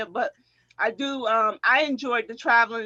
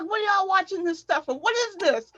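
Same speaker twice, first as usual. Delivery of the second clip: disapproving coach; 7 semitones higher than usual. Retold or imitated. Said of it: "and he's like".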